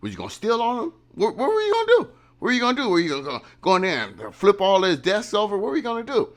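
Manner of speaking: squeaky, high-pitched mock demanding; mock challenging commenters. Vlogger parodying commenters on what he should have done, high mock challenge. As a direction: mimic indignant viewers, voice leaping far up. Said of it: "some of you say in the comment".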